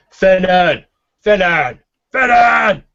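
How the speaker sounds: building, high-pitched loud yells; shouting to back room. Acted. Orc barkeep summoning the cook from the back room, shouting. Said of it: "he shouts back".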